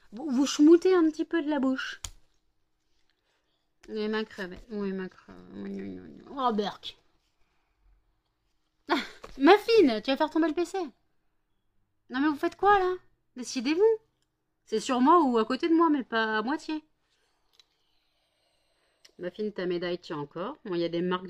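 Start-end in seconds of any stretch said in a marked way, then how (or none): 0.1-2.0 plus aiguë et plus forte, jouée; voix taquine. Acted. Taquine les chiens, voix jouée. 8.9-16.8 plus aiguë et plus forte, vive; exclamation agacée. Real, not acted. S'exclame, chiens sur l'ordinateur.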